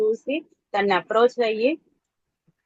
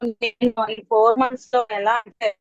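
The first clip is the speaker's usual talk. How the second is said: ordinary talk, nothing marked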